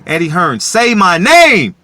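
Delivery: slow, loud, high yell of the name; shouted demand. Real, not acted. Calling out Eddie Hearn, a slow drawn-out shout. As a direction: bellow it at him like a challenge.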